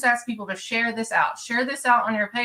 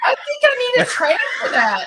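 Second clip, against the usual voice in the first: laughing through the words; giggling while talking; 13 semitones higher, 6 dB louder than usual. Real, not acted. Laughing after being teased.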